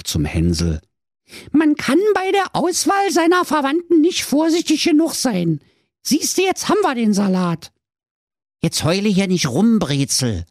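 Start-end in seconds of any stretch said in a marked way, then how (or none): 1.5-7.7 put-on very high, wailing girl voice; hammed-up weeping. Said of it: "Da weinte die Brezel". 8.6-10.5 lightly voiced, higher-pitched Hänsel; brother scolding. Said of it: "sprach Hänsel".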